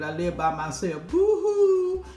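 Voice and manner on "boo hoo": high, loud fake sobbing boo-hoo; playful fake boo-hoo; mock boo-hoo. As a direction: ham up a pretend cry. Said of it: "I don't have nobody to play with".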